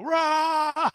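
loud, high-pitched roar; battle roar. Acted. Game master acts Copper Tongue going at the goblins, battle roar. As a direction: let out a big "rah" war cry. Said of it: "Copper Tongue's gonna go".

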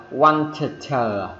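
"wanted" broken slowly into "want-tuh-tuh"; wrong doubled T. Shown as a demonstration. Teacher mocking the rule misapplied to want, doubled T. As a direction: stutter two separate Ts after "wanted"'s first syllable. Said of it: "Thì nghe rất là kỳ".

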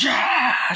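very high, loud mock shriek; jump-scare scream. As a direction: burst out with a sudden scream.

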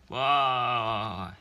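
loud, drawn-out "wah" wail; mock bawling. Friends allergic to sadness, acted bawling. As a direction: burst out in a cartoon sob. They burst into tears.

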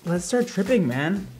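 ordinary delivery

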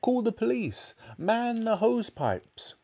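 high, drawn-out emergency cry. A narrator's reading. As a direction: call for help in panic. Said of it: "cried Missus Cranky".

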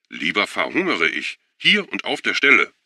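rising to a loud, high snap; defiant outburst. Acted. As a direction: build to a loud defiant finish. Schuwan refusing the canapés.

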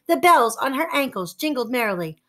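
ordinary delivery, nothing marked

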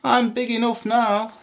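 high, loud little voice; baby elephant. A narrator's reading. Baby elephant answering mum where she'll go, high and eager. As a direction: announce it like an eager youngster. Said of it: "said the baby elephant".

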